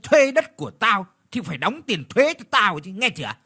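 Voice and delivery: loud, high, bullying snarl; snarling landlord. Acted. Landlord bullies the old woman over tax, snarling. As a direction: bark the threat down at her. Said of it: "rồi gằn cái giọng".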